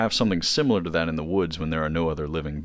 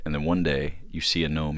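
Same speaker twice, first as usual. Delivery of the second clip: ordinary delivery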